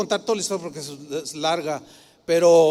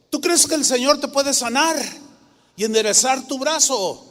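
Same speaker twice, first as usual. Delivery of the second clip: fervent question; 6 semitones higher, 4 dB louder than usual. Retold or imitated. Praying sister challenges grandfather's faith.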